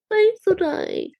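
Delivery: slow, drawn-out and pitched high for a mock-heroic ring; sarcastically crown him the hero; a hammed-up hero's salute. Mocking echo of heroic praise.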